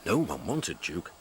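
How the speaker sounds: plain reading voice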